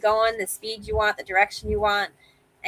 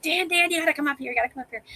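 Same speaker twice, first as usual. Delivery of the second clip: urgent call; 8 semitones higher, faster than usual. Retold or imitated. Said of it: "and I'm like".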